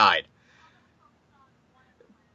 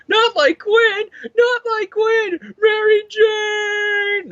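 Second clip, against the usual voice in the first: high, long-drawn wailing yell; hammed-up scream, far higher and louder, stretching the final name; mock anguished scream; 4 dB louder, much slower than usual. Hero screams as his wife falls, hammed-up anguish. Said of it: "Peter screaming".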